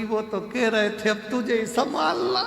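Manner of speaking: rising, high whine trailing off; put-on sob rising to the end; tearful mock wail. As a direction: act a weeping wife, hammed up. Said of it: "पत्नी रोती रोती बोली".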